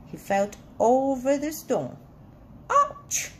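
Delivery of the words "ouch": high, loud storybook "ouch"; yelp of pain. A narrator's reading. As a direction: yell it sharply, far higher, as if hurt. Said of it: "he yelled".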